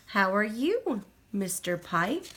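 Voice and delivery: ordinary delivery, nothing marked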